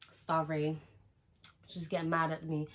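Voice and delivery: ordinary talk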